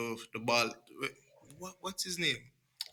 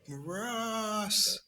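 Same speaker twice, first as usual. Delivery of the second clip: surprised gasp; 8 semitones higher, 5 dB quieter than usual. Retold or imitated. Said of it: "you'll be like".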